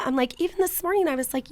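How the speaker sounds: ordinary talk, nothing marked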